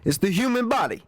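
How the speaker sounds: jokey, high, put-on voice; playful high-voiced protest; high-pitched mock excuse. Jokey excuse for having gas.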